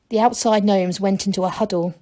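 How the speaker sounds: usual speaking voice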